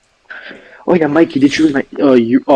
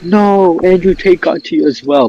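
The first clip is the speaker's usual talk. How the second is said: shocked exclamation; 8 semitones higher, 3 dB louder than usual. Retold or imitated. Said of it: "I remember I was like".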